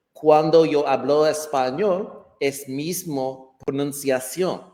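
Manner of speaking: Spanish pronunciation, for demonstration; speaking Spanish. Shown as a demonstration. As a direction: switch smoothly into Spanish. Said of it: "speak just very quickly in Spanish".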